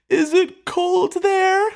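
high, slow, wobbling question; high, slow mock weepy cardinal; mock tearful. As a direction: ask it like he's about to bawl. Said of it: "about to openly start bawling".

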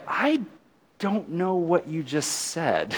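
high, slow, soft mock bewilderment; mock puzzlement. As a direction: draw it out high in hammed-up uncertainty. Acts out puzzled reaction to saying.

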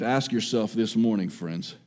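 ordinary talk, nothing marked

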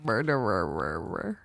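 MURDERER very slow and low, the R syllables dragged out; low, slurring MURDERER with endless Rs; exaggerated practice. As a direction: drag out every ER in MURDERER. Practising a hard word exaggeratedly.

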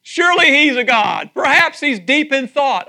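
loud, slow mocking shout; taunting shout. Elijah taunting the prophets of Baal, loud mocking shout. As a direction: jeer at them across the altar. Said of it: "He says".

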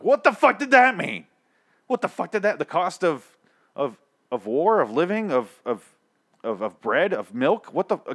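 high-pitched exasperated rant; indignant, incredulous outburst; mad. Real, not acted. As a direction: burst out loud and high, then sputter in disbelief. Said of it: "I'm fucking mad".

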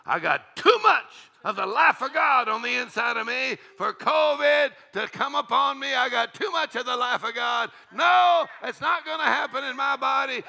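high, loud declaration of faith; defiant shout. Acted. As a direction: shout it down, pitched right up. Retold man jumps up defying covid.

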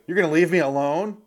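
high, loud mock complaint; mock whiny wife. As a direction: whine it like a clingy wife. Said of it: "And she's like".

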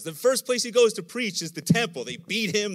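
normal talking voice

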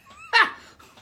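loud high laugh breaking out; real laugh at own story; high burst of laughter. Real, not acted. Laughing at peeing pants.

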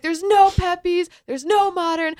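high, loud mock-alarmed announcement; retold rallying speech. Film-maker addressing crowd by the front door, retold yelled speech. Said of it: "giving them this, like, speech".

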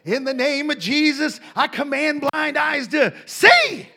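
high, loud, slow command; mock healer command, shouting; commanding prayer. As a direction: order the blind eyes open. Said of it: "I'm just like".

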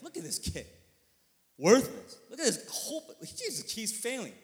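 loud, high, hammed-up contempt; mock scornful passer-by. Passer-by judging the pastor's kid, mock scorn. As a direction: jeer at the kid in an exaggerated put-on voice. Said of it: "put it into her mouth".

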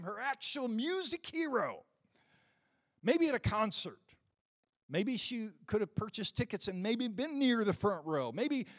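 loud, high preaching build. Acted. Preacher tells the congregation his point, in loud high preaching. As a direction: drive the point home to the congregation.

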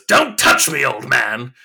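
high, loud snapping Joker yell; freaking out. Retold or imitated. Joker recoiling from a pleading mobster, loud high freak-out. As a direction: lash out, suddenly unhinged. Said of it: "freaks out".

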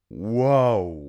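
wow, big and stretched; loud awed whoa. Real, not acted. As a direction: blown away. Awed reaction.

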